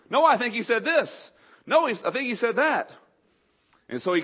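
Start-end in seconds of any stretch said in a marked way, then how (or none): 0.1-2.9 higher, louder squabbling voices; bickering generals. Retold or imitated. Generals arguing over a command, mock bickering. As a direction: argue over what was meant. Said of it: "each one of them would interpret it differently".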